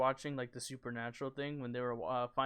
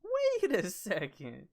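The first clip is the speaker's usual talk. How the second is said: suspicious alien; 5 semitones higher, 4 dB louder, slower than usual. Acted.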